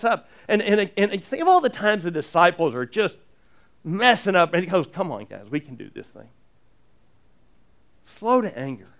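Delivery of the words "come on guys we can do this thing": soft, low, patient coaxing; gentle encouragement. Retold or imitated. Jesus gently encouraging his bumbling disciples, soft and patient. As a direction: talk them back up like a kind friend.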